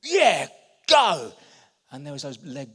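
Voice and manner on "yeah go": high, loud burst of yes; rallying yell; triumphant shout. Retold or imitated. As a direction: shout it out like a breakthrough. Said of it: "he thought".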